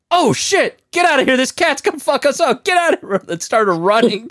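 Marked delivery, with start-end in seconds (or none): 0.1-3.2 high, mock scared yelp; mock panicked adults. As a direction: flee from the cat in a hammed-up panic. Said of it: "they go".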